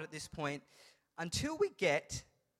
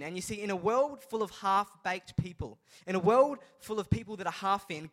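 raised, emphatic preacher's voice; impassioned preaching; 4 dB louder than usual. Acted. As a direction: drive the moral home with fervour. Preaches the moral fervently.

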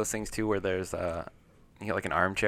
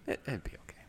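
whispering; 14 dB quieter than usual. Pretend. Joking deadpan repeat.

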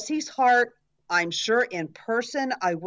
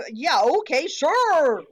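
loud, high, sing-out sarcasm; exaggerated mocking assent; sarcastic agreement; 7 semitones higher, 5 dB louder, slower than usual. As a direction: agree as if it's utterly ridiculous. Mocking a donation request.

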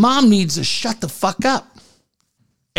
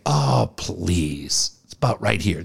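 low, quieter, dismissive mom; 11 semitones lower, 5 dB quieter, slower than usual. Retold or imitated. Mom dismisses the daughter's complaint in a low voice. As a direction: brush it off with a low scoff. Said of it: "And the mom's like".

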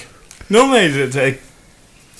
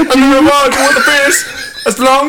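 put-on Kentucky accent; 12 semitones higher than usual. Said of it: "You should be reading it in a Kentucky accent".

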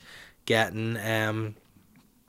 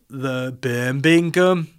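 slow, louder stab at Brummie; badly faked Birmingham drawl; attempted Birmingham accent; 2 semitones higher, 5 dB louder, much slower than usual. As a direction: try out a Birmingham accent, stretching it. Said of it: "an accent from".

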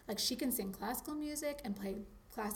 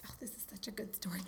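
whispering; 3 semitones lower, 11 dB quieter, much faster than usual. Real, not acted. Aside whispered before story.

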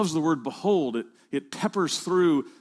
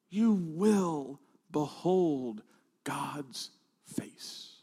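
slow, drops to a breathy unvoiced whisper on God's face; fading into awe; 3 semitones lower, 7 dB quieter, much slower than usual. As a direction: let the awe take your voice away.

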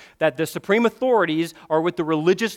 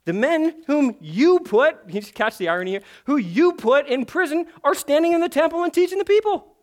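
dramatic scripture reading; 10 semitones higher, 5 dB louder than usual. Acted. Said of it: "someone came and told them".

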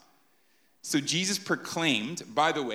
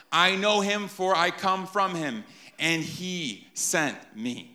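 proclaiming; 3 semitones higher, 3 dB louder than usual. Retold or imitated. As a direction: declare it to the temple crowd. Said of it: "he is basically shouting out this truth".

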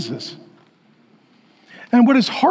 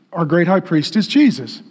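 ordinary talk, nothing marked

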